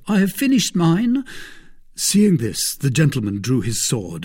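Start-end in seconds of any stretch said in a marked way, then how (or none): 0.1-1.2 high-pitched servant's call; crying out. A narrator's reading. Servant tells master his man is down, crying out. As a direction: announce success to your master. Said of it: "cried Planchet".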